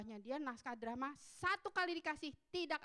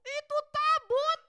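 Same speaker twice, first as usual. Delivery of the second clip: high, loud alarmed exclamation; frightened Philistines; 9 semitones higher, 9 dB louder than usual. Retold or imitated. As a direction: gasp in awe and warn each other. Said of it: "Orang Filistin takut loh".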